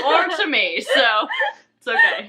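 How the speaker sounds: laughing, voice climbing high; self-mocking laugh. Real, not acted. Joking to her co-host about no romance, laughing. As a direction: laugh off the sad joke.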